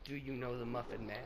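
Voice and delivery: low, hushed storyteller voice; dramatic story narration. Acted. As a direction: tell it like a grave legend.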